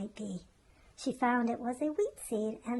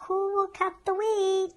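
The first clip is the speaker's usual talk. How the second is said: higher, louder brisk call; 8 semitones higher, 6 dB louder than usual. A narrator's reading. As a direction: hen calls out across the yard. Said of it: "calling briskly".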